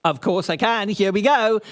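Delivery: ordinary delivery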